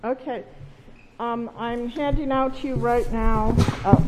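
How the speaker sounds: muffled voice through a face mask. Real, not acted. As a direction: speak with a mask over the mouth. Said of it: "Can you hear me with this mask on?".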